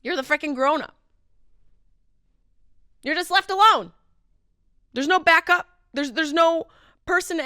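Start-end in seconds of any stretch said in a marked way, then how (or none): none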